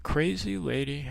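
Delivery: a private aside, barely voiced; muttered thought. Thinking to himself after the call.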